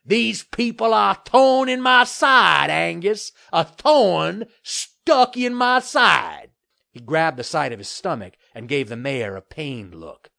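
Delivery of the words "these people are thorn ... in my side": loud, slow, high-pitched grievance; aggrieved bluster. A narrator's reading. Developer griping to the mayor about these people, aggrieved bluster. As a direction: drag out the complaint with loud indignation. Murtaugh complains bitterly to mayor.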